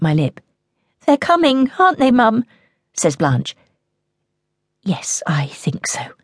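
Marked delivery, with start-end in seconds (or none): 1.0-2.4 put-on high, pleading girl's voice; anxious child. Frightened child asks mother. 4.8-6.1 soft, whispered yes; mother quietly confirming the fear to her daughter; hushed reply. Acted.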